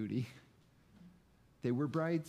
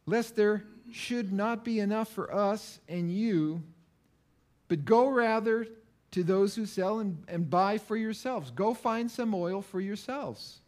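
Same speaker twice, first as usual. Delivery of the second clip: louder, higher insistence from the wise virgins; emphatic refusal; 5 semitones higher, 4 dB louder than usual. Acted. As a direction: tell them firmly to go buy their own. Wise virgins answer the foolish.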